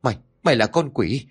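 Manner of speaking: high, rising panic at a corpse; trembling and frightened. A narrator's reading. Mrs Minh shouting at her dead daughter-in-law's corpse, terrified. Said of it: "nói bằng một giọng run rẩy, xen lẫn vẻ hoảng sợ".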